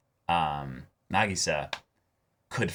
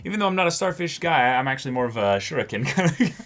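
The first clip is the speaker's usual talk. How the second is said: much higher, louder goofy persona voice; put-on joke voice; 8 semitones higher, 5 dB louder than usual. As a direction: boast like a cartoon ninja fan.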